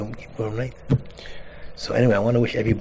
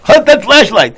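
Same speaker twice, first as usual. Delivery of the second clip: very loud, high crowd shout; screaming yell; 13 semitones higher, 16 dB louder, slower than usual. Retold or imitated. Chorus from the back at flashlight man, screaming. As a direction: holler it from the back rows. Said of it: "screaming and yelling at him".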